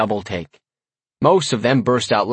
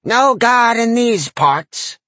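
slow, high, loud sneering granny; sneering old lady; 12 semitones higher, 5 dB louder, much slower than usual. A narrator's reading. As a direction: sneer it with relish. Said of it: "the old lady sneered".

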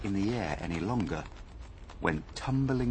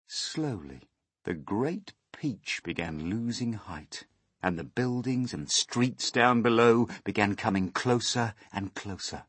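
ordinary delivery, nothing marked

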